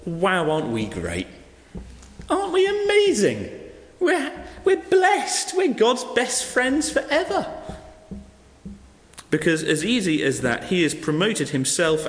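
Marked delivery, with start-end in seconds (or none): 0.0-7.5 high, sing-song mock self-praise; smug mock boasting. Preacher mocking Pharisees with smug boasting.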